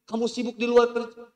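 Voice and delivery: high, very loud shrieking rant; wife shrieks at her husband about housework; furious wife. Acted. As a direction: unload every grievance at full volume. Wife's furious shouted rant.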